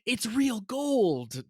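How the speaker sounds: high, drawn-out showing off; mock wowed boast. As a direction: stretch it out high as if to dazzle friends. Mock boast to wow friends.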